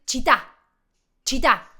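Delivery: "città" as cheet-TA; Italian pronunciation. Shown as a demonstration. Teacher translating city, "città" with final stress. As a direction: stress the last syllable of "città", double t. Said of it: "Ciudad se dice".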